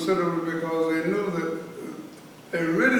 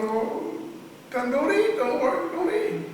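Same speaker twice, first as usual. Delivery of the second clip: blunt retort; 11 semitones higher, slower than usual. Retold or imitated. Retort to striking inmates, quoted.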